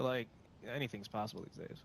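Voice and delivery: usual speaking voice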